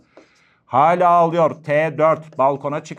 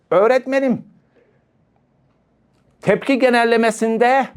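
"Öğretmenim" called out higher and louder, like a keen pupil; eager student voice; 6 semitones higher, 4 dB louder than usual. Acted. Lecturer playing a student who calls out to the teacher, higher and louder.

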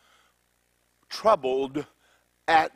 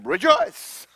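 loud, higher "Rejoice!" then a big laugh; booming angel; 11 semitones higher, 8 dB louder than usual. Acted. Angel bursting in on Mary, booming and laughing. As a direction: boom the greeting and laugh at her. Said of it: "read it as though Mary really didn't".

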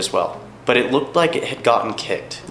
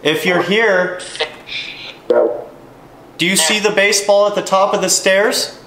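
calling out; 7 semitones higher than usual. Real, not acted. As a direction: call up to the unseen. Calling out to spirits.

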